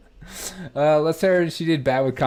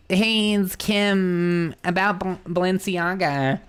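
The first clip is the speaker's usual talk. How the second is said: put-on slow, drawn-out Valley Girl accent; Valley Girl accent, lazily stretched vowels; Kim Kardashian Valley Girl voice; 4 semitones higher, slower than usual. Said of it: "let's do Valley Girl accent".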